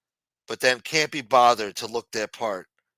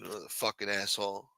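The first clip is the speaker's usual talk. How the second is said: ordinary talk, nothing marked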